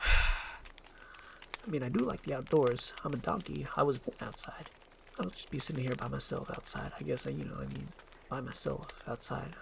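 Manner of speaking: lonely donkey muttering to himself; quiet sulk. Acted. Donkey sulking alone outside.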